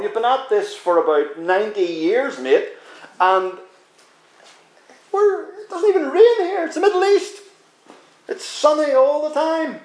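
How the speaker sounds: high, mocking jeer at the boat builder; taunting neighbour. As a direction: scoff at the old man and his silly boat. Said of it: "you taunt at him".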